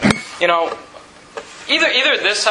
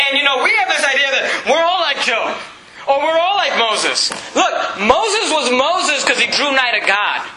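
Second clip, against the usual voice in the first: higher-pitched, impassioned sermon delivery; forceful preaching; 6 semitones higher than usual. Real, not acted. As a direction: preach it hard, voice lifted.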